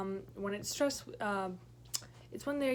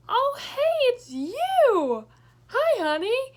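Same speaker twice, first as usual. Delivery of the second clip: high, loud mock-cheery greeting; creepy guy's greeting; 14 semitones higher, 12 dB louder, much slower than usual. Creep spots her and calls out sweetly, high and loud. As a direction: call out way too cheerfully across the yard. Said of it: "and then I hear".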